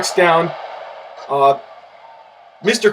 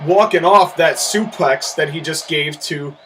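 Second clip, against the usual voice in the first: ordinary talk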